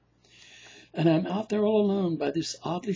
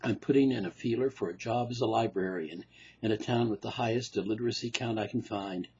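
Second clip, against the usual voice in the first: lower, dry psychiatrist voice; the analyst brushing off his patient; low deadpan shrink; weary doctor; 6 semitones lower than usual. Acted. Doctor voice musing.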